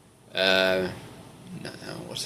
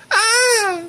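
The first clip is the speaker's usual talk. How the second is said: very high held "ah"; sustained put-on wail; high mock cry; 3 dB louder than usual. Reader warming up for an impression, high mock cry.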